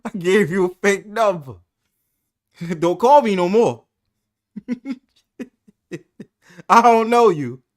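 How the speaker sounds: high, loud, laughing retell; laughing mock quote. Host laughing while quoting friend's rejection. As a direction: quote him while cracking up.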